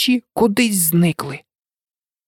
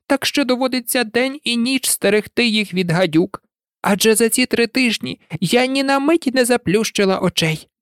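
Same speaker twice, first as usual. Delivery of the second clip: higher, complaining bird voice; a weary mother pigeon griping; lightly voiced fretful pigeon; 7 semitones higher than usual. A narrator's reading. Pigeon voice held. (скаргливий голос голубки)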